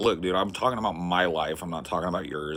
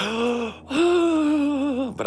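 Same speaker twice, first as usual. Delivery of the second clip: loud high gasp; sharp gasp; 5 dB louder than usual. Acted. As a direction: gasp in mock shock. Mock shock at new viewers.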